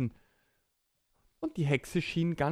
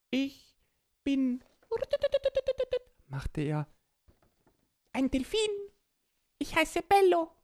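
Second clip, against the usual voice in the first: raised cartoon dolphin voice. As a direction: play a friendly dolphin. Dolphin character speaks.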